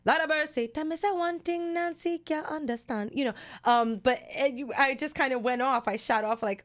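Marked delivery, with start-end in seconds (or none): 0.1-3.1 put-on a lightly done Jamaican accent, pitched higher. Listing her accents for the writers, in a light Jamaican accent. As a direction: rattle off a quick Jamaican patois sample. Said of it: "I told them I could do Jamaica".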